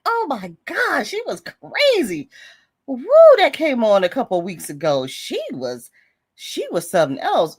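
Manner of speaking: ordinary talk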